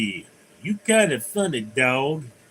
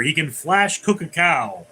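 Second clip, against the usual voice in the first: normal talking voice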